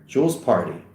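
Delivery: usual speaking voice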